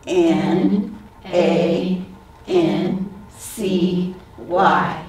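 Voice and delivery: each letter named slowly and separately; spelling out slowly. Shown as a demonstration. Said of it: "Now we're gonna name the letters".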